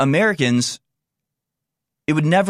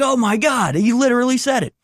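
much higher, stunned exclamation; shocked disbelief; 12 semitones higher than usual. Real, not acted. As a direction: gasp it out, can't believe it. Realizing the clip matches his words.

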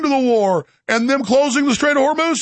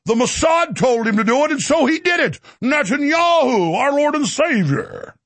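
high, mock-reverent pulpit cry; hammed-up worshipful sermon; affected preacher voice; 4 semitones higher than usual. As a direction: proclaim it like a zealot preacher, slowing grandly. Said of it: "Why don't you affect that voice".

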